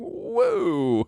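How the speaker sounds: mock cheer. Mock celebration of plot convenience.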